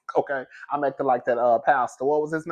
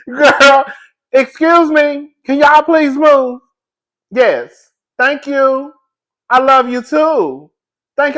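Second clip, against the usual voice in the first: calling out; 8 semitones higher, 6 dB louder, much slower than usual. Acted. Calling to crowd from above.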